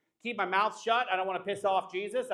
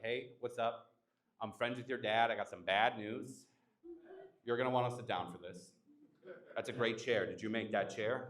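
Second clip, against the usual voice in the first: low, much quieter bearer of bad news; deadpan angel; 9 semitones lower, 7 dB quieter than usual. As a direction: break the news gently and low. Angel breaks news to Jesus.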